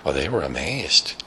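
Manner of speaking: ordinary delivery, nothing marked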